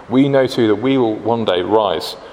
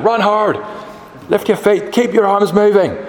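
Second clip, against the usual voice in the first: high, put-on coach's yell; shouting PE teacher; 11 semitones higher than usual. Remembered school PE teacher urging pupils to run, high mock shout. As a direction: imitate a PE teacher driving runners on. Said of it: "still have his voice in my head".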